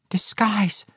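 small, high hushed voice; high whisper. A narrator's reading. Injured halfling explains himself at sword point, whispering high. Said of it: "whispered Regis".

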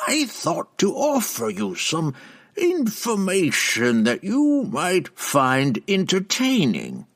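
high, slow sly witch voice; sly old witch. Acted. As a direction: dangle the bait slyly. Benray's voice.

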